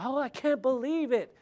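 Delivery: high, loud scandalized gasp of disbelief; mock outrage. Preacher mimicking outraged Facebook posters, mock outrage. Mocks viral online outrage.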